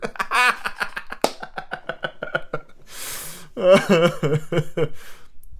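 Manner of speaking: long helpless wheezy laugh; real laughing fit. Real, not acted. Reader tickled by Dickens's joke, real helpless laughter. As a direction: crack up and keep giggling.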